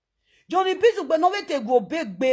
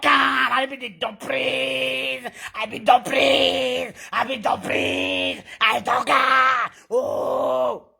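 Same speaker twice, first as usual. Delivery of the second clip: slow shouted boast breaking into a mock cry; hammed-up wailing brag; 3 dB louder, much slower than usual. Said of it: "so I talk".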